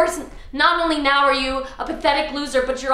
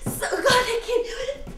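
laughing, breathless give-up; exhausted laugh as she quits; laughing defeat; 11 semitones higher than usual. Acted. Gives up laughing.

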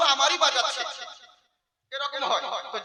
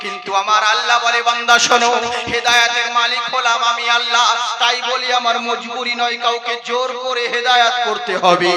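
loud, fiery sermon rebuttal; preaching at full force; 10 dB louder than usual. Acted. Preacher rebuking the guardian before the crowd, fiery and loud. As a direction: thunder the answer at the excuse.

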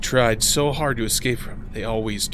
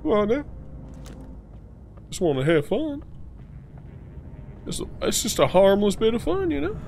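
coaxing; 11 semitones higher than usual. Pretend. Coaxing the ghost.

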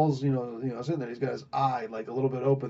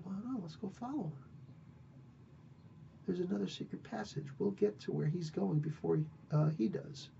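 softly, a little higher; hushed; 5 semitones higher, 11 dB quieter, faster than usual. Retold or imitated. Sneaking after the advisor, hushed and a little high.

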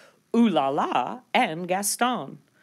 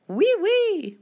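very high, louder delighted oui; happy agreement; 5 dB louder than usual. Acted. Relieved poodle mother agreeing with bulldog mom, delighted. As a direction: trill it with relief. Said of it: "Missus Poodle agreed happily".

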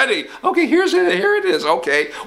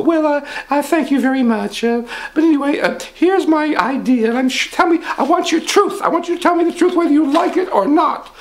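higher pompous rich man; 11 semitones higher than usual. Acted. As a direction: puff up as the rich boss.

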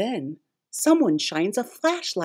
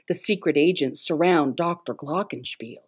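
ordinary delivery

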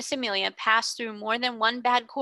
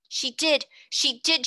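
higher, louder near-hysterical insistence; hysterical cry; 5 semitones higher, 4 dB louder, slower than usual. A narrator's reading. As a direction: blurt it out, losing control. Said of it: "she cried".

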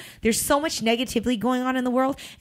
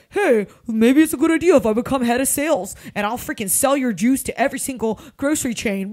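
lightly acted, louder eager sales pitch; 4 dB louder than usual. Recruiter pitching herself to juice company owners, eager mock pitch. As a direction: pitch yourself keenly, a touch hammed-up.

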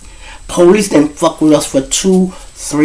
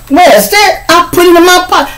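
high, fast playful dodge; high mock reply; 3 dB louder than usual. Dodging the question about the money, high quick reply. Retold argument over money.

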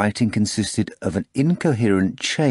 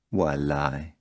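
softer, low and quiet; quiet question; 5 semitones lower, 6 dB quieter than usual. A narrator's reading. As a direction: drop the voice, almost to himself. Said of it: "Pilate asked quietly".

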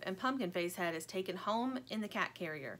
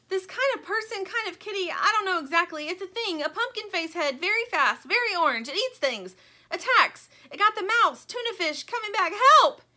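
high, loud, breathless panic; frantic cry; 11 semitones higher, 10 dB louder than usual. A narrator's reading. Panicked buffalo begging the towel for help, frantic. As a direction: blurt it all out in a frightened rush. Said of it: "he cries".

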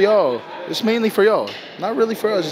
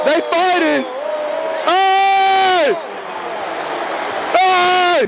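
shouting at fight; 10 semitones higher, much slower than usual. Real, not acted. Players fight on the field, fan yelling. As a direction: call out at the brawl.

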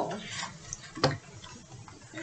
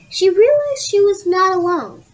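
higher, louder reveal of the surprise; dramatic reveal; 8 semitones higher, 8 dB louder than usual. A narrator's reading. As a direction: land the twist with a loud lift. Suspense build in story.

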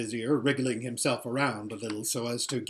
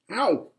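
sharp high "ow" as if kicked; pained yelp; 7 semitones higher, 6 dB louder than usual. Acted. Vizier kicked mid-speech, short pained yelp. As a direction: yelp at a sudden kick.

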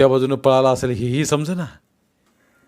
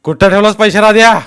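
very loud, high demand; angry shout; 9 semitones higher, 13 dB louder than usual. A narrator's reading. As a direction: yell the question at the suspect. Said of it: "राध्यावर ओरडला".

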